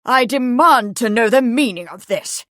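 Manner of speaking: loud, outraged lord; angry, commanding bellow; roar. A narrator's reading. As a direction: roar it as an indignant demand. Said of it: "a voice roared".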